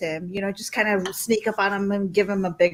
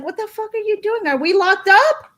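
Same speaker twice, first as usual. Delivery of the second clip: high, quick mock disbelief; high-pitched quoted outburst; imitated incredulous partner; 9 semitones higher, 4 dB louder than usual. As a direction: ask it like a startled, exasperated onlooker. Partner voiced reacting to goofy behavior.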